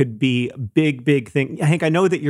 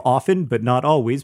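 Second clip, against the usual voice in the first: ordinary talk, nothing marked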